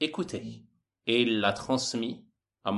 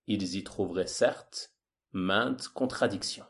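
ordinary talk

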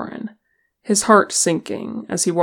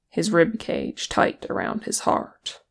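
ordinary delivery

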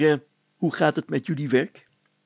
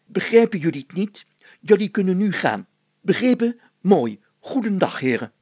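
firm command; 6 semitones higher, 7 dB louder than usual. Acted. Mayor orders workers off.

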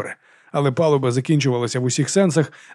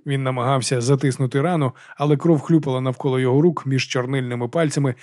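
usual speaking voice

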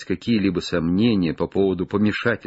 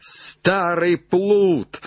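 high, drawn-out exclamation; relieved shout; 8 semitones higher, much slower than usual. Acted. Legrand scolds Jupiter with relief, drawn-out shout. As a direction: cry it slowly with relief. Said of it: "закричал Легран с видимым облегчением".

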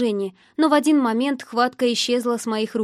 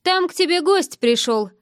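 higher-pitched, amused announcement; 6 semitones higher, 4 dB louder than usual. A narrator's reading. Drunk man telling her she has a visitor, amused. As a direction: tease lightly, as if chuckling. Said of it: "произнёс со смешком один".

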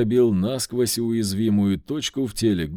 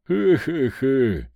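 guffawing; 2 semitones higher, 4 dB louder, slower than usual. Acted. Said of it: "заржал Вольт".

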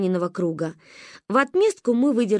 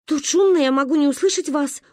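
stubborn mother; 7 semitones higher than usual. A narrator's reading. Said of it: "упиралась мама".

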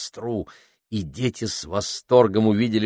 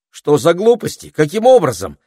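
high, loud horrified cry; girl crying out in horror at the news; 9 semitones higher, 9 dB louder than usual. A narrator's reading. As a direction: gasp it out in horror. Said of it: "в ужасе воскликнула Пенелопа".

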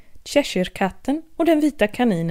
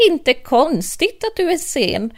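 hatter; 6 semitones higher than usual. Acted. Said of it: "hattaren ... sa".

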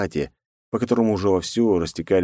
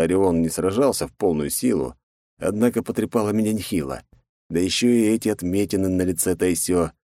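usual speaking voice

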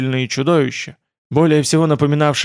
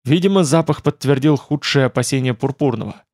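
usual speaking voice

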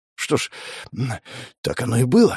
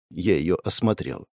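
ordinary delivery, nothing marked

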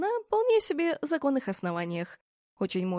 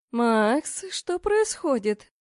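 capricious little princess; 4 dB louder, much slower than usual. Acted. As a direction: drag out the words like a spoiled girl. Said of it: "капризно протянула его спутница". (капризно, тягуче, как избалованная принцесса)

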